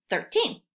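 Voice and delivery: Thirteen said whole after the syllables; blended whole word. Shown as a demonstration. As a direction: join it back into Thirteen. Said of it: "that's our first syllable".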